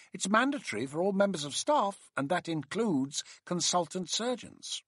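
high-pitched mock reproof; mockingly disapproving. A narrator's reading. Colleague tells a consultant surgeon it's mandatory, mockingly disapproving. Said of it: "in a mockingly disapproving voice".

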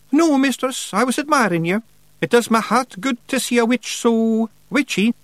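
high, loud Scots pictsie; cheeky wee Feegle. Acted. Feegle flatters the witch, cheeky.

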